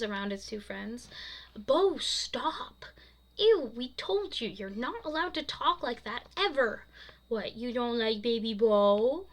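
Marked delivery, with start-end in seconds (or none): 1.5-6.8 high, loud protest; grossed-out scolding. A narrator's reading. Girls telling Bo off for baby talk, exasperated. 7.3-9.3 put-on slow, loud babyish pout with a rising end; drawn-out baby talk, loud and questioning. A narrator's reading. Bo teasing the girls in baby talk. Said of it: "sorry, it's in his no-, normal voice".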